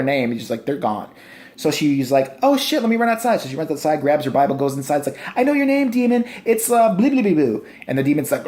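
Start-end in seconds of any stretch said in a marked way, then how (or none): 2.4-3.4 high-pitched, hammed-up urgency; mock Lorraine Warren. Lorraine dashing out for her Bible, high mock voice. 5.4-7.6 high, hammed-up proclamation; mock Lorraine Warren. Lorraine confronting the demon by name, high mock voice.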